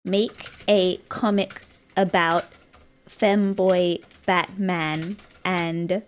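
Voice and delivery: drawn-out, word by word; dictating slowly. Real, not acted. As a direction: pace it to match typing. Dictating a message while typing.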